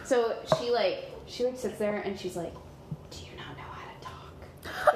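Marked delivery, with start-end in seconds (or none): 3.1-4.9 low, whispered probing; soft, confidential aside; hushed question. Acted. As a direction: whisper it to someone who won't answer. Game master voices nosy stranger probing silence.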